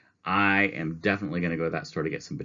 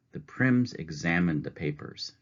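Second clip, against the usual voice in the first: ordinary delivery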